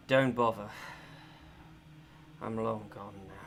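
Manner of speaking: low, soft, dry rasp; raspy, hoarse voice. Acted. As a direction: speak like a weak, parched prisoner. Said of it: "very hoarse voice say".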